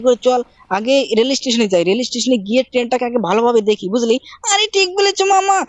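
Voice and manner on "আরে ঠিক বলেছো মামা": put-on high, pleading little-boy voice; Shinchan child voice. Shinchan nagging his uncle, high childish voice. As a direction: pitch way up and whine at mama like a small kid.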